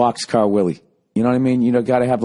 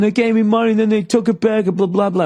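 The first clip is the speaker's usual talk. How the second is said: high, raised complaint; complaining yell; 9 semitones higher than usual. Retold or imitated. Kid yelling to the doorman about the money, high and raised. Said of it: "the kid's going".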